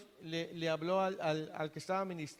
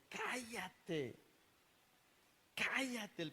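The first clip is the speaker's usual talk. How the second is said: higher-pitched, restrained demon cry; demon's mock scream; 5 semitones higher, 7 dB quieter, much slower than usual. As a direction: imitate a demon crying out without real volume. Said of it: "aquel demonio pegó el grito".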